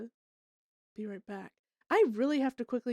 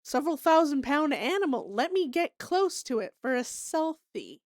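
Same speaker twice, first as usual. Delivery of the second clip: mock airhead gushing over a bison; clueless tourist voice; 6 semitones higher, 7 dB louder than usual. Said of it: "People are like".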